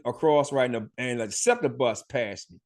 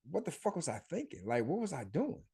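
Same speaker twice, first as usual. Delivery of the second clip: regretful self-talk; 13 dB quieter than usual. Retold or imitated. Self-reproach, inner thought.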